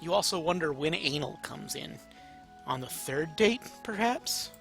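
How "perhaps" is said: ordinary delivery, nothing marked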